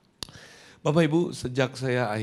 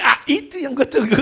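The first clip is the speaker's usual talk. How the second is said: high, loud gleeful outburst; mock triumph; 14 semitones higher, 8 dB louder than usual. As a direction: burst out high as if you won. Acts triumph at awaited command.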